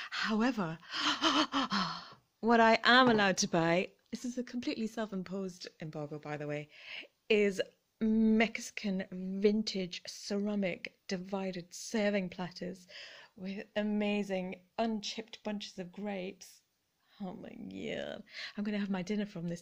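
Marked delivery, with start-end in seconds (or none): 0.8-2.1 a sharp delighted gasp; excited gasp. Real, not acted. A gasp of delight over the find. Excited about vintage finds. 17.2-18.2 soft slow awe; awed hush. Real, not acted. Awed over the vintage platter, softly.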